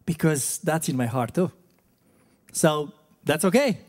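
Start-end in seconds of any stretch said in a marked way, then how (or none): none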